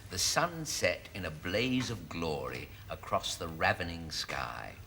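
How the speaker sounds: low, measured novel reading; story narration. Acted. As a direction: read it as overblown rustic romance. Reads a racy novel aloud.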